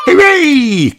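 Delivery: high, loud happy cheer; cries hooray. A narrator's reading. Danny cheering that Daddy is coming home. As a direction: cheer like an excited pup. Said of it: "cries Danny".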